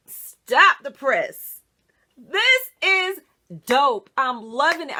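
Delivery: loud, drawn-out yell of delight; screaming. Real, not acted. Thrilled with the wig, yelling it's dope to viewers. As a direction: shout it like breaking news. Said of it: "I'm screaming".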